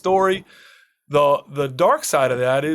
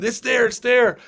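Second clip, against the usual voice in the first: higher-pitched mock yell, no real volume; retold scream; 6 semitones higher than usual. Helicopter crewman calling down to the searchers, mock scream. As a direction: imitate an urgent call from the helicopter. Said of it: "one of the guys in the helicopter is screaming".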